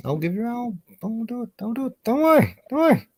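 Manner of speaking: very high, thin, pleading voice; squeaky high voice. Acted. Voice jumps very high.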